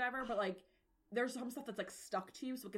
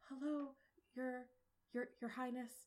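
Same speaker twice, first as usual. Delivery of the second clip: halting, higher-pitched timid greeting; nervous stuttering; 5 semitones higher than usual. Acted. Game master as the mom greeting the princess, stuttering nervously. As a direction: falter between words as if meeting royalty. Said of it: "stuttering through your whole name".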